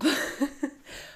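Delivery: breathy laugh. Real, not acted. Laughs at own Botox joke.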